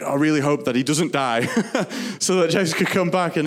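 quoted prayer with a laugh in it; laughing quote. Retold or imitated. Laughing while quoting her prayer.

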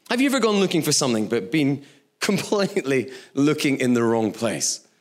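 ordinary talk

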